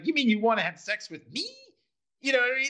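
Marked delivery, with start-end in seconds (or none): 0.1-1.5 higher-pitched question rising at the end; mock incredulous. Receptionist misreading the caller's intent, mock incredulous. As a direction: act out a mildly astonished receptionist. Retold receptionist escalating the misunderstanding.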